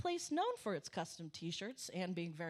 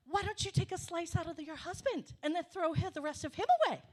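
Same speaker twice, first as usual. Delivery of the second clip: animated commenter; 8 semitones higher, 4 dB louder, faster than usual. Retold or imitated. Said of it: "started to get a little animated".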